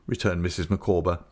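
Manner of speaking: ordinary delivery, nothing marked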